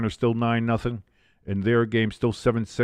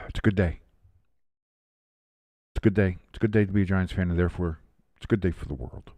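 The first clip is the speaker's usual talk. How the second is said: contented; 4 semitones lower, 3 dB quieter, much faster than usual. Real, not acted. Contented sigh after win.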